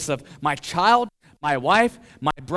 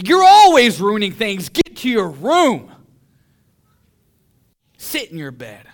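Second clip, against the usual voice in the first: loud, high re-enacted yell at a child; angry yell; 7 semitones higher, 13 dB louder than usual. Preacher re-enacts yelling at his child over spilled coffee, angry yell. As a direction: bellow the scolding, then drop on the last order. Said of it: "I yell at them".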